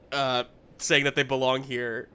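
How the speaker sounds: normal talking voice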